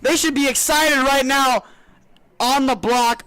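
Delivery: loud, high, thrilled reaction; excited commentary. Real, not acted. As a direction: shout it like you can't believe it. Color commentator reacts to run.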